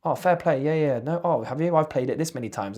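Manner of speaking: lightly voiced gamer caught out and backing down; a hint of an embarrassed troll; mock sheepish gamer. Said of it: "they come back and go".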